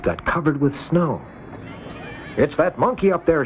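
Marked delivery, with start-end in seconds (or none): 2.4-3.4 raised, higher accusing call; shouted. A narrator's reading. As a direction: point and shout at the monkey. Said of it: "shouted someone".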